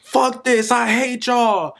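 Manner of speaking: imitating a screaming kid. Teacher retelling a furious boy's cursing, high mock yell. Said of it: "He was screaming".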